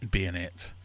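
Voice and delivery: usual speaking voice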